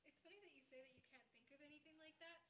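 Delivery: ordinary talk, nothing marked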